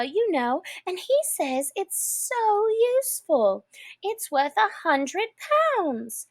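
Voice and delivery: put-on high, eager girlish rush; eager chatter. A narrator's reading. Alice chatters eagerly to the mouse about a dog. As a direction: gush about the little dog. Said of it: "Alice went on eagerly".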